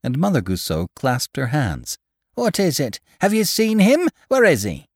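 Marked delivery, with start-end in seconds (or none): 2.3-4.9 high, loud, urgent questions; an old wife desperate for news; old wife pleading with the stranger, high and loud; anxious old woman. Acted.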